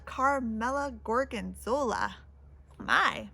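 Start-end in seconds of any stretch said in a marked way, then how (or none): none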